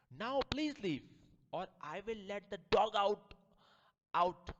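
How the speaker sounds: loud, high threat to leave or face the dog; angry shout. Acted. Lady of the house shouting at the seller to leave. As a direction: yell him out of the house.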